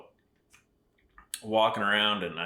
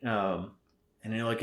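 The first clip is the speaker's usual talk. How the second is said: ordinary talk, nothing marked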